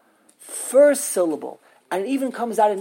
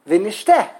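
vi-nish-TE, with TE punched louder; stress on last syllable; 7 dB louder than usual. Shown as a demonstration. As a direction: hit the final te in Vi, nishte. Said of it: "pronounce this one of three ways".

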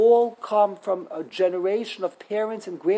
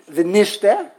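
vi-NISH-te, with NISH punched louder; stress on middle syllable; 6 dB louder than usual. Shown as a demonstration. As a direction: hit nish in Vi, nishte. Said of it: "pronounce this one of three ways".

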